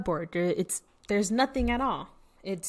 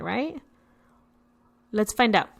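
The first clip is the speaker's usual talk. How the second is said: normal talking voice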